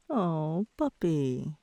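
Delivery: normal talking voice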